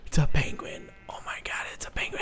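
hushed, breathless whisper after a gasp; awed whisper. Real, not acted. Gamer gasping at a penguin, awed whisper. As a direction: whisper it in astonishment.

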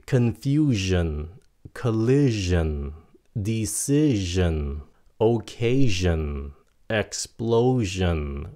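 slow word list, "sion" with buzzed zh; "jin" endings. Shown as a demonstration. Teacher gives vowel-rule examples, "sion" as "jin". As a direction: model each word slowly, "sion" as voiced "jin". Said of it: "nó là âm jin".

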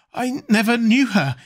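understated panicked stammer; frightened stammer. A narrator's reading. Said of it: "he stammered".

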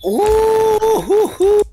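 high excited hooting; excited whoop. Real, not acted. Streamer reacts to the shot with high whoops. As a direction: whoop at a big play.

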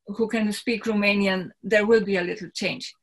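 ordinary talk, nothing marked

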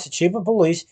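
ordinary delivery, nothing marked

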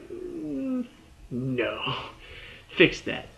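a high, drawn-out doubtful "Mm" that drops to a flat no; mock-disgusted verdict on a bad wig; skeptical no. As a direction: pretend to be a picky viewer rejecting the shot.

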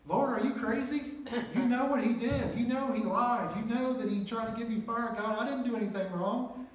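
high, loud, indignant shouting at God; a man arguing with God, shouting up at him in indignation; angry protest. Retold or imitated. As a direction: yell your case to the Lord, outraged. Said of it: "I was so angry".

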